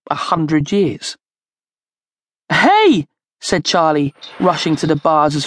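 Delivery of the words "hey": high, loud shout; calling out. A narrator's reading. Charlie at the bars to the jailer, shouting. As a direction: shout to get the jailer's attention.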